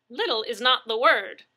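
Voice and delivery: loud, scornful interjection. A narrator's reading. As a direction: speak up so the phone catches it. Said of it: "her voice raised so the dispatcher".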